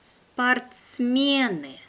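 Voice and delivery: slow and careful, drawn out for learners; teacher's careful slow reading. Shown as a demonstration. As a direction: spell it out slowly for the class. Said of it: "one first time will be slow".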